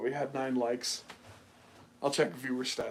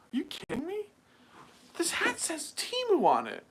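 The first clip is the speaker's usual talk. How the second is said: very high, squeaky disbelief; mock outrage. Customer balking at seventy-dollar Temu hat, high mock outrage. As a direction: protest in a hammed-up voice pitched way up.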